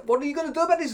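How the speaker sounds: high, loud mock-angry demand. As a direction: imitate an irate passenger demanding action. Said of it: "got very angry, understandably, and said".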